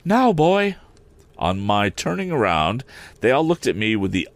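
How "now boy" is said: higher, louder curt command; curt summons. Acted. Estella ordering Pip out, higher and louder. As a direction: snap it at the boy.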